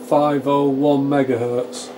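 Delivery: ordinary talk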